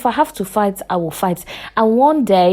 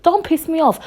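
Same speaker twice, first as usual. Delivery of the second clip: high and sharp outburst; 9 semitones higher than usual. Real, not acted. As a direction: snap it out with real anger. Warning someone who provokes her.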